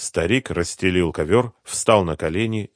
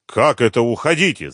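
raised, indignant question; furious yell; 5 semitones higher, 6 dB louder than usual. A narrator's reading. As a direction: throw the order back in anger. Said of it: "завопил разъярённый Мурат". (возмущённо, повышая голос)

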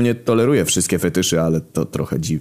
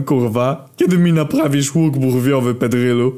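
raised, forceful impression of a famous countryman; imitating the greatest Pole; 8 semitones higher, 3 dB louder than usual. A narrator's reading. Narrator imitating Poland's greatest man to demand a repair, mock impression. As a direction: put on a higher-pitched mock great-man voice. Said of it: "naśladując głos największego Polaka".

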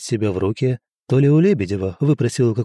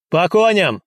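called-out command; 7 semitones higher, 4 dB louder than usual. A narrator's reading. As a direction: call the troop to horse. Commander calls the troop to mount.

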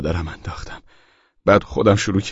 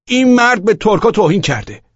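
high, raised denouncing voice; indignant, pitched-up complaint; loud accusation; 11 semitones higher, 5 dB louder than usual. A narrator's reading. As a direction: denounce him loudly to the police. Accuser denounces man to policeman.

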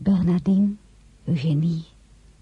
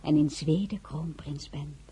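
soft intimate near-whisper; murmured secret between lovers; hushed secret talk; 10 dB quieter than usual. Acted. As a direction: confide it softly, barely voiced.

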